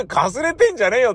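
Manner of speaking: high, quick heckling jab; comic retort. Retold or imitated. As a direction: play the straight man pouncing on a slip. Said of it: "ちょっと突っ込みどころ".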